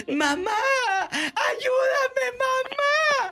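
mocking the scam caller's fake crying; imitating crying plea. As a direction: ham up a kidnapped daughter wailing. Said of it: "llore y llore".

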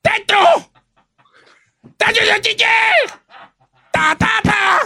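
botched intro yelled as a joke; silly shout. Acted. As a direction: yell nonsense syllables like a clown. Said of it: "ya dilo bien".

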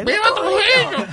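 high, very loud parrot screech; screaming parrot. Retold or imitated. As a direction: shriek it like a worked-up parrot. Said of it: "más gritaba ella".

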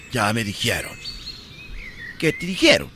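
hushed, low melodramatic accusation; gasping soap opera drama. Acted. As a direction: play the betrayed lover in a telenovela. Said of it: "imagínate que le dijera así".

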